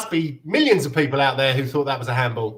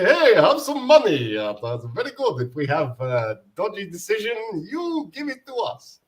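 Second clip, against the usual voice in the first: attempted Russian accent; 3 dB quieter than usual. Imitating club owners buying a decision, attempted Russian accent. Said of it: "I was doing it in a Russian accent".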